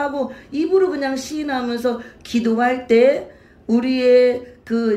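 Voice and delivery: fervent sermon delivery; preaching. Acted. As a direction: drive the point home to the congregation. Preaching to congregation.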